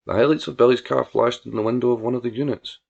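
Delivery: ordinary delivery, nothing marked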